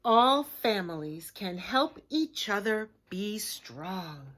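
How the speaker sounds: slow, emphatic read. Shown as a demonstration. Reading the strong line slowly to kids. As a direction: lay each word down slowly. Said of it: "the way I reread that".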